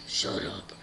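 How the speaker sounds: deep, hushed villain order; Lord Voldemort. Acted. Villain silences the squealing girl, deep whisper. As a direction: cut it off in a low whisper. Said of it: "And Lord Voldemort's like".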